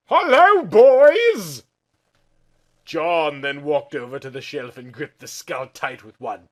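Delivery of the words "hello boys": loud, high eerie creature greeting; a twisted alien thing calling out; crooked alien voice. Acted. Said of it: "She whispered in a crooked alien voice".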